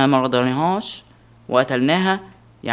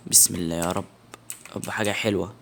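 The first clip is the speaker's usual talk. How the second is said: hushed prayer; 6 semitones lower, 8 dB quieter than usual. Real, not acted. Nervous prayer for good loot.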